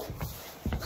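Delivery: sharp acted gasp; exaggerated intake of breath; mock shocked gasp. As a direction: gasp as if caught out. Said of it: "I'm like".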